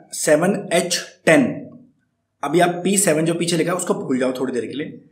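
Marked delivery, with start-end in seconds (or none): 0.1-1.7 slow, each part spelled out; seven h ten said very slowly. Shown as a demonstration. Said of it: "कुछ नहीं करना है".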